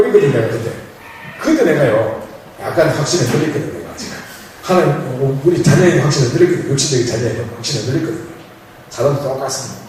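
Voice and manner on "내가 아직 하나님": ordinary delivery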